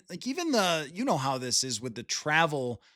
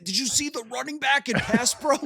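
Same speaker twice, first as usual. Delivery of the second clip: higher, eager question; excited; 9 semitones higher than usual. Retold or imitated. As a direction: quiz the wife excitedly. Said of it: "do the same thing".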